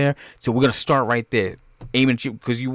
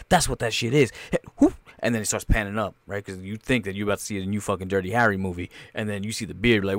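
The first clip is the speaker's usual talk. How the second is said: ordinary talk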